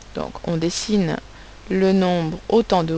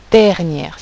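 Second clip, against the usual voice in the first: dernière drawn out on its first syllable; stress on dernière; 4 dB louder than usual. Shown as a demonstration. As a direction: lean on the opening of dernière. Teacher contrasts first and last syllable.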